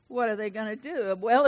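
usual speaking voice

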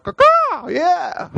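high, strutting mock peacock caw; proud peacock caw. Officer mocking his own pride as a peacock, high caw. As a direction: crow it like a cocky bird showing off. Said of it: "I was walking around like".

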